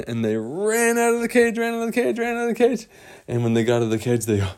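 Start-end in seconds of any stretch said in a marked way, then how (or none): 0.1-2.9 high, light and urgent; breathless escape narration. A narrator's reading. Storyteller tells a child of the escape, high and urgent. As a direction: keep it high and softer, tumbling along in repeats.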